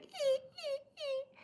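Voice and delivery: very high, thin mewling cries; whiny little kitten cry, imitated. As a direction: mimic a crying newborn kitten. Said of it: "he's always crying".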